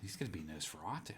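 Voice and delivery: soft, half-whispered joke; hushed aside. Real, not acted. Co-host joking aside about the actor's next role, hushed. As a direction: slip it in under your breath.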